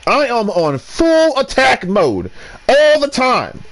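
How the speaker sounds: high, slow declaration; hammed-up attack-mode boast; mock battle cry. Real, not acted. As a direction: announce it like a warrior.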